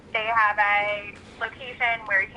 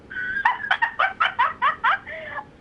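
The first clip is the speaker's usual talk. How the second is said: high laughing fit. Real, not acted. Laughing at mom impression.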